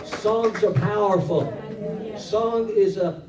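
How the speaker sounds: slow, loud and deliberate; slow emphatic. Real, not acted. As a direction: lean into every word. Slowed emphatic preaching.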